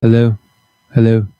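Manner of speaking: loud, short testing hellos; calling out. Real, not acted. Host testing the live stream's sound, calling out loudly. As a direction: call out loudly to check you are heard.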